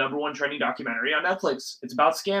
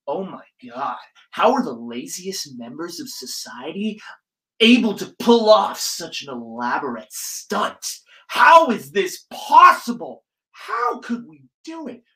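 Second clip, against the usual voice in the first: mock gushing American; 3 semitones higher, 6 dB louder than usual. Mocking America's fascination with scammers, in a gushing put-on voice. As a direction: gush in disbelief like a fascinated viewer. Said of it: "and, like".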